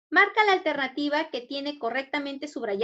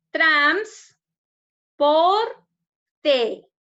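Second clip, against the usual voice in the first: split into syllables; 2 semitones higher, 8 dB louder, much slower than usual. Shown as a demonstration. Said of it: "Separemos en sílabas".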